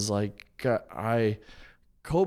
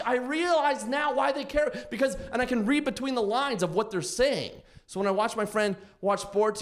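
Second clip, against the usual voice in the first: usual speaking voice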